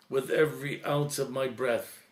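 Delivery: usual speaking voice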